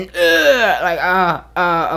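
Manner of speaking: big exclaimed jolt of realization; acted gut-feeling groan. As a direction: groan as if suddenly hit in the gut. Acting out intuition hitting the body.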